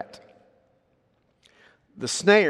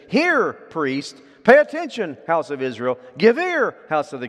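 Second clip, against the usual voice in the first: each call word higher and louder; escalating calls; 4 semitones higher, 7 dB louder than usual. Shown as a demonstration. Preacher showing how Hosea calls everyone, rising stress. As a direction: build from hear to give ear. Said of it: "Notice he says".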